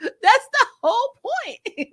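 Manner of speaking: high, slow, laughing words; laughing while explaining the joke. Real, not acted. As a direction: talk through a high giggle.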